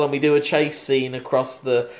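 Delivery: normal talking voice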